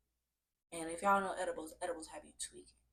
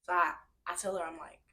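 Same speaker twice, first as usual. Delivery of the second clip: normal talking voice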